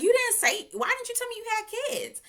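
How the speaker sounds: high, loud incredulous demand; shocked question. Retold or imitated. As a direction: hit it like you just found out. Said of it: "I was like".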